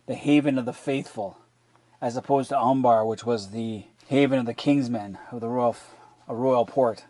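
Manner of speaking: ordinary talk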